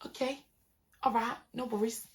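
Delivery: very soft, deflated retold reply; resigned. Watching the passer-by walk away, quietly resigned. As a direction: let it drop under your breath.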